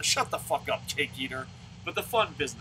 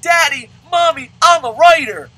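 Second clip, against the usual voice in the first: put-on high, loud, kid-like proud cry; childish boast; 14 semitones higher, 17 dB louder than usual. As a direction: shout it like a kid showing off to his parents. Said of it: "and I'm like".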